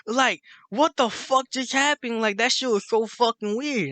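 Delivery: high, drawn-out freaked-out disbelief; tweaking out. Retold or imitated. Tito freaking out after the strange encounter, incredulous. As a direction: imitate a friend losing it in amazement. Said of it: "Tito started tweaking out".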